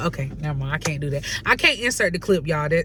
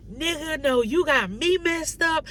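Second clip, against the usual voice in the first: higher, sharp don't-play-me retort; sassy retort; 8 semitones higher than usual. Retold or imitated. Arguing with her man, sassily shutting him down. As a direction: put him in his place with attitude. Retold argument, snapping back.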